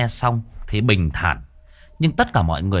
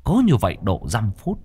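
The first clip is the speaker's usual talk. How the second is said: ordinary delivery, nothing marked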